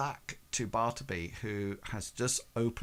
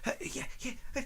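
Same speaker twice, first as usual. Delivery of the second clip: flustered servant; 12 semitones higher, 6 dB quieter, much faster than usual. Acted. Nervous servant answers the master.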